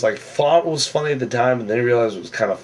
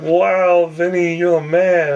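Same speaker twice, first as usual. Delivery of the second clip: higher, louder mock cheer from the group; retold wow, a bit louder and higher; impressed cheer; 7 semitones higher, 3 dB louder, slower than usual. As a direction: hype him up like an impressed crowd. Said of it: "everyone's like".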